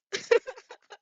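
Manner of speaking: high, bursting laugh; cracking up at the line; real laugh. Real, not acted. Reader cracks up at scene.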